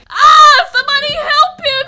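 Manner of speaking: high, loud desperate scream; screaming for help. Acted. Calling for help in panic.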